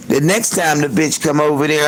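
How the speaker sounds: ordinary talk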